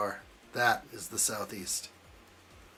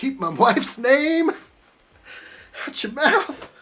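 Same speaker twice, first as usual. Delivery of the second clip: high, loud, slow mock outrage; mock furious yell; 13 semitones higher, 9 dB louder than usual. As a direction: yell it like a husband defending his wife, hammed up. Famous slap scene reenacted.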